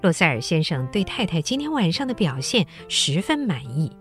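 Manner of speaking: normal talking voice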